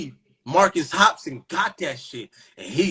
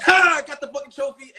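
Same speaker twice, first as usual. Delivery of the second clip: high, loud victory cry; triumphant whoop; 10 semitones higher, 4 dB louder, faster than usual. Retold or imitated. Sperm cell gloating at its rivals, triumphant high cry. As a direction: crow over the win. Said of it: "This what you did".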